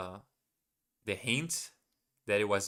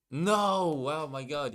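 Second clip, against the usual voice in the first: loud, high, drawn-out no; dismayed cry; 5 semitones higher, 4 dB louder, slower than usual. Real, not acted. Learner catches own forgotten rule, dismayed cry. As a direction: cry out at your own slip. Learner realises a repeated mistake.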